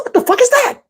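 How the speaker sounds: high-pitched comic incredulity; baffled outburst. Retold or imitated. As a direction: demand it in a squeaky, confused voice. Acts bafflement at word Wiccan.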